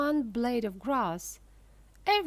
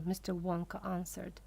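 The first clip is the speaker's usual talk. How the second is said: plain reading voice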